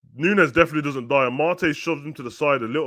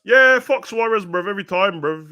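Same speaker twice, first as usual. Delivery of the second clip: angry outburst; 8 semitones higher, 7 dB louder than usual. Real, not acted.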